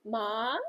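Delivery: Ma? rising, asking mom for money; hopeful rise on ma. Shown as a demonstration. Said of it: "like when I'm asking my mom for money".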